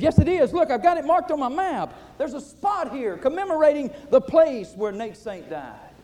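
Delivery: put-on eager young man pointing at his map; excited. Journeyman missionary insisting to the pilot, excited and loud. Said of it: "with excitement again said".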